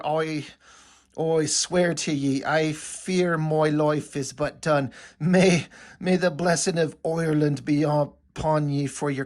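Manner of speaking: slow, thick mock-Irish brogue; hammed-up Irish farewell blessing, drawn out; Irish leprechaun accent. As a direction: drag it out like a dying stage-Irish leprechaun. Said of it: "the way to give him his accent".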